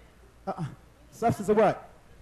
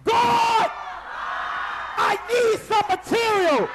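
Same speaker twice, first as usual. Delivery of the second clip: full-voiced shout, higher and louder; shouting like you mean it; 10 semitones higher, 4 dB louder, slower than usual. Shown as a demonstration. Told to mean it, preacher gives an all-out shout. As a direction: cry out to God with everything. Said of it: "Now you better say it like you mean it".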